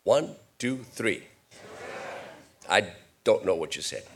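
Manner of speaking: normal talking voice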